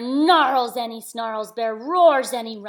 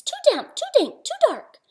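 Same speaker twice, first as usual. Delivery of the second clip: high squeaky mouse voice; 5 dB louder than usual. Acted. Reader voicing Mouse for a child, high and squeaky. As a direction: squeak it out like a tiny fussy mouse.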